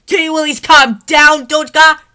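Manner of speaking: very high, loud scolding yell; rude yell. Acted. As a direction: yell back at her daughter. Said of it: "Maria yelled in a rude response".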